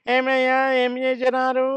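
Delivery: very high, monotone mock-teacher chant; mimicked teacher. Comedian showing how another teacher used to talk, high-pitched caricature. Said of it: "అని ఒక ఆయన మాట్లాడేవాడు".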